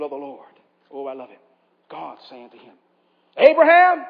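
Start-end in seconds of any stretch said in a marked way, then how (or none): 3.4-4.0 loud, high call of the name; booming voice of God stopping Abraham; divine call. Acted. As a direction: call out Abraham from heaven, ringing.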